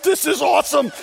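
high, loud gushing imitation; over the moon. Woman delighted by everyone's lucky charms, over the moon. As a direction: gush like a thrilled woman, hammed up. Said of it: "is now over the moon".

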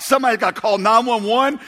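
frightened newcomer yelling for an ambulance, imitated; retold panicked yell. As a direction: shout for help in a hammed-up panic. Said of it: "I start yelling".

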